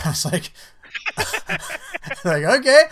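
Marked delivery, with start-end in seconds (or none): 0.6-2.2 high breathy laugh. Real, not acted. Laughing at the tax form. 2.4-2.8 mock okay. Mock-agreeing with the software.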